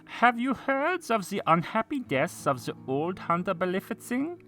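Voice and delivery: high theatrical vassal voice; hammy stage actor. A narrator's reading. Vassal voiced in narrated tale.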